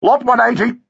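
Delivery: high, rattled-off lot call; gabbling auctioneer. A narrator's reading. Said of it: "gabbled the auctioneer".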